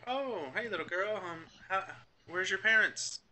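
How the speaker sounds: put-on high-pitched shop lady greeting a child. Parent greets the little girl at the shop door, high-pitched greeting. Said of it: "They're like".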